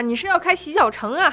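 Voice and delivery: ordinary talk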